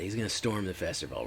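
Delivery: usual speaking voice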